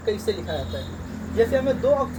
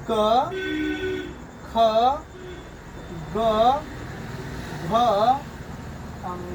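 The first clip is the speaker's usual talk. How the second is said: teacher reading the alphabet aloud for pupils; letter drill. Shown as a demonstration. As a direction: call out each letter with a pause. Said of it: "जैसे इसको पढ़ेंगे".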